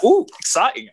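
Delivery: high, excited ooh; lightly acted delight, pitch jumping up. Acting out usual excitement on plane.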